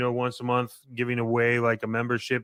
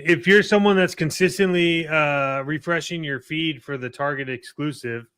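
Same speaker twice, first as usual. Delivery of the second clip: higher, louder hammed-up commercial voice; mock infomercial read; 4 semitones higher, 5 dB louder than usual. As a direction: sell it like a cheesy TV ad.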